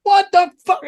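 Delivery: high, loud outraged shout; retold furious scolding; imitated yelling. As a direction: yell it like an angry bandmate. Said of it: "yelling at me".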